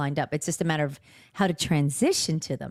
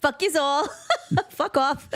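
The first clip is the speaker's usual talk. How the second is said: laughing mock tough; 10 semitones higher than usual. Playful mock tough talk.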